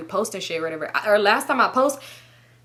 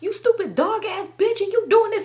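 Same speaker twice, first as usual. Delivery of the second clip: mock cussing out; 12 semitones higher than usual. Boyfriend cussing her out, mimicked fury. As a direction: mimic him chewing you out, pitched way up. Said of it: "Whole time he cussing me out".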